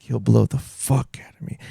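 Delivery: softly imitating a Russian host's misspoken phrase; a hint of Russian accent on "blow the fuck out of me"; light Russian accent. Retelling the host's funny slip, light Russian accent. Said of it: "the way he says it too".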